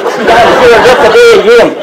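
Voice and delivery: high, loud mimicked watchman; calling out. Wally calls out the latecomer at the clock, loud and high. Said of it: "and Wally calls".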